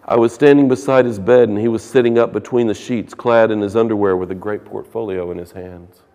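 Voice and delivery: loud, projected reading from the book; reading a novel aloud. Acted. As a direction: read the passage out to the class. Reads the novel passage aloud.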